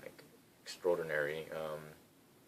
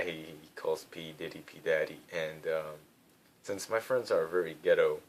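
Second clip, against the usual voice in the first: ordinary talk, nothing marked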